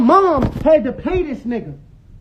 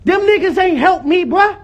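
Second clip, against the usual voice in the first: higher, heated protest; yelling; 4 semitones higher than usual. Real, not acted. Son insisting he worked hard unaided, yelling. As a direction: insist hotly, voice raised in real anger. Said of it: "Stop yelling".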